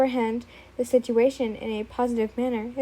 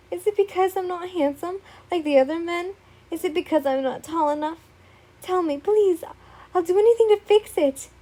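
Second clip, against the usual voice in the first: higher, rising desperate questions; insecure begging; 6 semitones higher, 4 dB louder than usual. Acted. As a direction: plead and offer anything.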